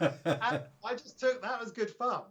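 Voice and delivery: amused, laughing while talking. Real, not acted. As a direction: chuckle through the words as you tell it. Laughing about a cheeky comment.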